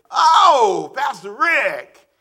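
high, loud "Oh" then slow "Pastor Rick"; excited greeting. Retold or imitated. Relatives at home gushing over the visiting pastor, mock excited.

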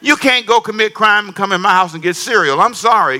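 ordinary talk, nothing marked

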